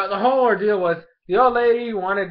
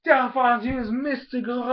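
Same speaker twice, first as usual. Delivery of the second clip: higher, mock trainer command; old man commanding; 7 semitones higher than usual. Vlogger voicing the old man ordering Delphox, high mock command. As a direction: call the move out like the old man, lightly hammed. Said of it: "he's like".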